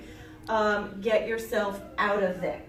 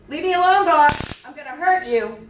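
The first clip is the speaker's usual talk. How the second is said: loud warning shout; 5 semitones higher, 9 dB louder than usual. Shown as a demonstration. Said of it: "Now you can show him".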